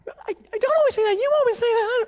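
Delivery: very high falsetto retort; mock a wife squabbling back shrilly high; arguing wife. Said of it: "pretending to yell".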